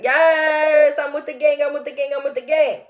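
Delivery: high, loud fake excitement; mock party hype. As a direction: whoop it up for the phone camera. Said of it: "they act like they're having a good time".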